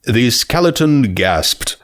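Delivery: usual speaking voice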